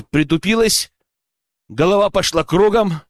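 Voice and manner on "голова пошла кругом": usual speaking voice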